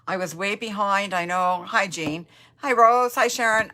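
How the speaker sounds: usual speaking voice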